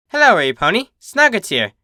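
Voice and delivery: brighter, upbeat sign-off; channel outro. Real, not acted. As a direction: greet the viewers cheerfully. Reader's outro announcement.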